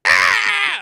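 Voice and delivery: high, loud cry of exasperation; hammed-up howl of self-disgust; frustrated scream. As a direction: let out an anguished mock scream. Retold frustration after botched audition.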